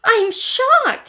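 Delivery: high and loud shocked cry; little I shocked at the news. A narrator's reading. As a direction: gasp it out in shock. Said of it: "said little I".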